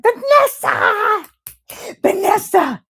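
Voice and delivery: high delighted call, slow and loud; excited calling out. Real, not acted. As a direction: call her name out in joy. Greets a friend joining chat.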